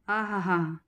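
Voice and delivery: a soft, drawn-out "aah-ha-ha" of relish; savouring aah. Acted. Kamli recalling roasted corn to Rishi, soft savouring aah. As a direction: sigh with delight at the memory of the taste.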